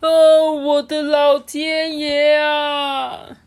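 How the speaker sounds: loud, high, long-drawn wail; alarmed exclamation. A narrator's reading. Doctor tumbling onto a hay cart, loud dismayed wail. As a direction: cry out in storybook dismay.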